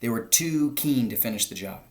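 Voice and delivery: ordinary delivery, nothing marked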